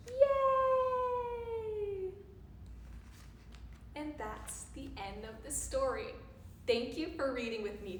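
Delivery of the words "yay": high, long-held whoop; elated storybook hooray; celebratory cheer. Acted. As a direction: cheer and stretch it out. Cheering the hero at the end.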